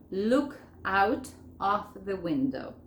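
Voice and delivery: "Look out of the window" slowly, each word separate; slow, unlinked words with gaps between. Shown as a demonstration. Teacher showing learners the phrase before joining, word by word. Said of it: "Vamos a unirlo todo esto".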